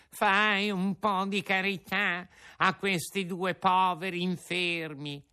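high, pleading whine; sly fox begging in a whimper; whining fox. Acted. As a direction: beg pitifully, fox style. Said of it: "con voce di piagnisteo".

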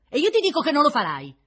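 loud, high contradiction; emphatic exclamation. A narrator's reading. Woman contradicting his promise, loudly exclaiming. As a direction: flatly defy him at full voice. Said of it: "esclamò lei".

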